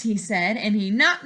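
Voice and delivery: usual speaking voice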